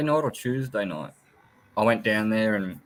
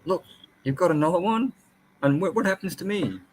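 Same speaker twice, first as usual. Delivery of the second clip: voicing the jealous lizard, higher and whiny; jealous pet voice; 8 semitones higher than usual. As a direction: pretend to be the sulky pet asking what about him. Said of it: "he's gonna be shitty".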